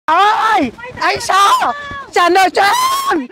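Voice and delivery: drawn-out shouted demand; insistent yell; calling out. Acted. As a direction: holler after a debtor, stretching the words. Debt collector calling out for debtor.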